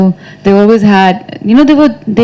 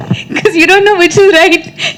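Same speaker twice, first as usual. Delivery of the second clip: higher voice, giggling at herself; laughing while talking; 6 semitones higher than usual. Real, not acted. Admitting she tried every spelling at school, laughing self-mockery. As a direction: confess it through bubbling laughter.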